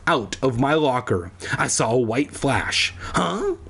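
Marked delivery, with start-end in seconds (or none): 3.1-3.6 high, rising startled yelp; high-pitched startled question; surprised cry. A narrator's reading. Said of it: "I cried out in surprise".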